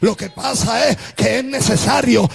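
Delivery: driving, chanted sermon cadence; full-force preaching. Acted. Preacher exhorting believers through their struggles, full-force preaching. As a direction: proclaim each phrase with sustained force.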